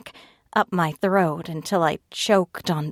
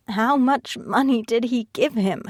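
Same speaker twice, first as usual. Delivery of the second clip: a touch of a cracking voice, pitched up; 6 semitones higher than usual. A narrator's reading. Ivy asks Edgar through tears, voice cracking. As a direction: let the voice catch on the question. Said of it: "My voice cracked".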